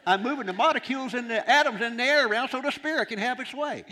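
loud, high-pitched imitation of Bob; exasperated explanation. Bob explaining moving molecules for the spirit, exasperated. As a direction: belt it out high, as if obvious. Acting Bob's impatient explanation.

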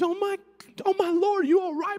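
high mock fretting wife voice; worried wife.

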